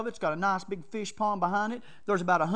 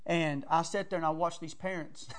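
ordinary talk, nothing marked

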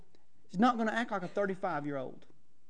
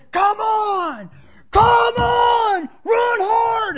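much higher and louder hammed-up shout; mock parent yelling; 12 semitones higher, 12 dB louder, much slower than usual. Overweight parent yelling at cross country kids, hammed-up shout. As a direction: bellow at the kids like a sideline parent.

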